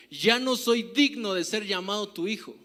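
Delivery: loud, emphatic preaching of the verse; loud preached quote. Acted. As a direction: proclaim the son's words loudly. Quoting the son loudly. (cita proclamada en voz alta)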